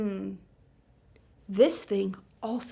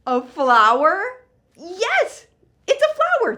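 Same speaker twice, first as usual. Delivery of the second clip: much higher, louder delighted answer; excited reveal; 7 semitones higher, 11 dB louder, slower than usual. Acted. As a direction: announce it with big delight.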